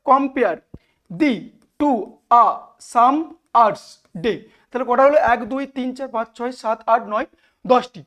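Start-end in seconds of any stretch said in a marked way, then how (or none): 0.1-4.3 each syllable set apart by a pause; halting, one-beat-at-a-time scansion. Shown as a demonstration. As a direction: count out the verse in separate syllables. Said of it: "আগে সিলেবেলে ভাগ করতে হয়".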